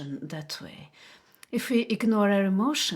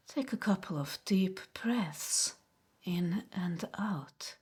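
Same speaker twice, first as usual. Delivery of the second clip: guided meditation. Acted. Host guiding viewers to notice body sensations, guided meditation. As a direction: guide them gently inward, unhurried, leaving space after each phrase. Leads a relaxation exercise.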